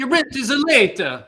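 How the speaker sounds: put-on high, loud scolding in Italian accent; hammed-up Italian landlord, impatient. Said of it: "my Italian Pablo Picasso landlord impression".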